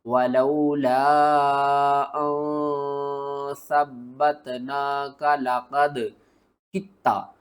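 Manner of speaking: very slow chanted reading, ending in a doubled कित्ता; drawn-out tajweed recitation; Quranic recitation. Shown as a demonstration. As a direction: recite slowly, stretching the words. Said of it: "आपने पढ़ना है".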